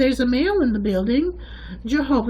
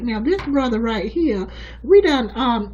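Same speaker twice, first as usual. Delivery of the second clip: ordinary talk, nothing marked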